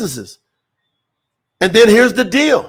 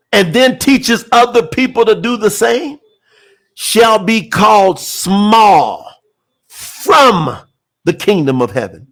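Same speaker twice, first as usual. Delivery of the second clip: very slow, stretching "small" and "from"; measured reading with weighty breaks before key words; slower than usual. Real, not acted. Preacher rereading the verse so all get it, slow reading. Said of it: "I'm gonna read it slow".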